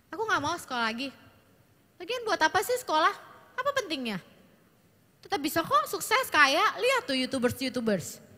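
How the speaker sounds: put-on sulky teenage daughter. Shown as a demonstration. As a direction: rattle off a defiant refusal of school. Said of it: "dengan intonasi segitu waktu ngomong sama orang tuanya". (anak perempuan membantah orang tua dengan nada tinggi)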